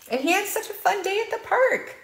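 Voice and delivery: high, bright storybook voice; storytime. Acted. Librarian closing the picture book for kids, bright. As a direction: wrap up the story sweetly.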